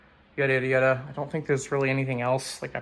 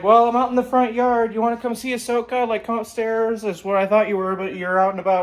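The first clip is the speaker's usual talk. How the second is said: higher-pitched voice for a chatty mom; retold motherly chatter in a raised pitch; imitated mom; 8 semitones higher, 4 dB louder than usual.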